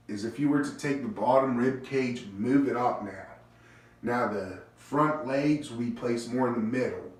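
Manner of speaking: normal talking voice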